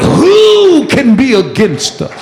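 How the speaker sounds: "Who" high and drawn out; emphatic preaching. Acted. Preacher answering the congregation's call, emphatic preaching. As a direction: ring out the answer, stretched and high.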